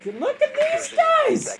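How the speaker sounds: slow, high squeal; high-pitched exclamation. Real, not acted. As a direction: squeal it out, stretched. Mocking the opposing squad.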